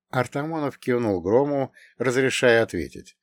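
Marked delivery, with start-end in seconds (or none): none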